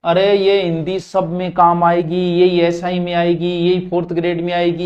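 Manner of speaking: ordinary talk, nothing marked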